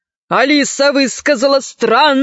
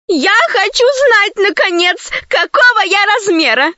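put-on high, much higher, shouting girl; shouted; 10 semitones higher than usual. A narrator's reading. Slave girl Zauri shouts, desperate to know her size, high and insistent. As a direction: demand an answer at last. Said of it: "закричала рабыня Заури".

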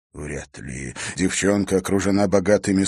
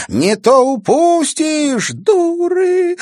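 very high, loud and slow; breathless; 9 dB louder, much slower than usual. Acted. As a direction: gasp it out while running. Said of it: "выдохнула Карина".

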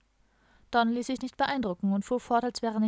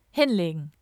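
louder, forceful order; dominant command; 3 semitones lower, 6 dB louder than usual. A narrator's reading. Policeman orders two tree thieves down, dominant. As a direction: order them down with authority. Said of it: "dominante Stimme".

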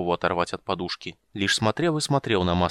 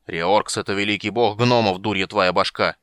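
louder, barking it out; gruff bark; 5 dB louder than usual. Acted. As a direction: snap at him like a grumpy old dwarf. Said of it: "рявкнул Флинт".

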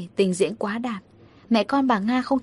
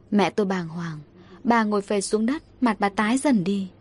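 ordinary delivery

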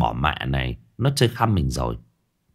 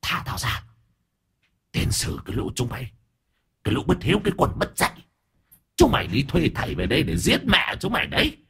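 put-on hissed, softer, possessed old woman cursing; spiteful hiss; 5 semitones higher, 5 dB quieter, faster than usual. The possessed grandmother curses her children, hissing softly. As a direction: hiss like a spirit in an old body. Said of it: "Bà già rít lên hằn học".

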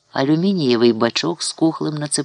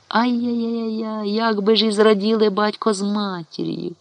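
rueful lament; 7 semitones higher than usual. Acted. Old neighbour laments absent parents' joy. (протяжно, з жалем)

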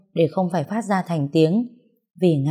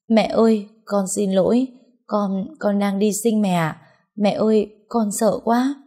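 acted tearful voice, higher at the plea; tearful confession; 4 semitones higher than usual. Frightened daughter confesses labour to mother, tearful. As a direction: plead with mum as if breaking into tears. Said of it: "Tôi bật khóc nói".

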